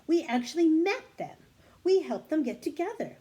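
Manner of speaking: high, loud excited telling. Acted. Annie excitedly telling Morgan they met the couple. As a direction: rush out the happy news.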